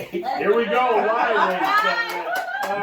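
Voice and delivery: high, loud and slow, with a laugh; laughing showman opener. Real, not acted. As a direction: announce the show live, beaming and laughing. Opening the live show.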